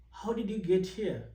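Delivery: normal talking voice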